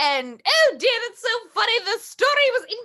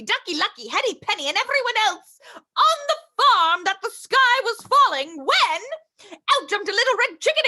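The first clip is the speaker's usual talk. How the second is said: plain reading voice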